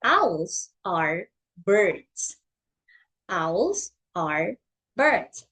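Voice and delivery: very slow, word by word "Owls are birds."; teacher reading "Owls are birds." slowly for a child to copy; slow model reading. Shown as a demonstration. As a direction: read "Owls are birds." at a crawl, each word clear. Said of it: "let's read this sentence".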